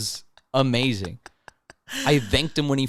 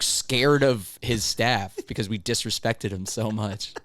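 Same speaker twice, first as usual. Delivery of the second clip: ordinary delivery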